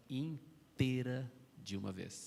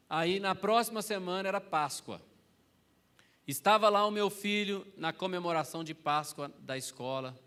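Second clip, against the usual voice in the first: higher and louder, preaching the story to the crowd; preacher raising the room with the story; preaching at full force; 4 semitones higher, 7 dB louder than usual. Acted. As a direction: build it up like a pulpit climax.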